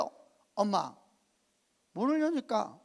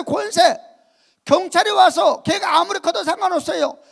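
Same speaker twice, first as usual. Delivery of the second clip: loud, higher preaching shout; a pastor urging the people to call on authority, preaching loudly; preaching at full force; 8 semitones higher, 14 dB louder than usual. Acted. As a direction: rouse the congregation about authority.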